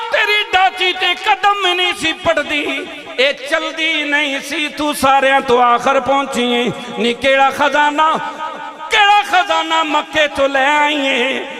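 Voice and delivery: loud, slow impassioned cries, leaping high; full-force preaching. Acted. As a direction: preach the scene with drawn-out calls. Long preached dialogue.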